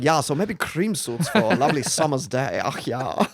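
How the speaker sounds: put-on jokey camp Germanic designer accent; Eurogay accent. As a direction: ham up a camp European accent, close to laughing. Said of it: "It's my Eurogay accent".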